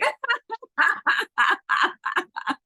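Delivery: long burst of high laughter; laughing. Real, not acted. Coach amused by a participant's self-congratulation, laughing hard. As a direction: crack up for real.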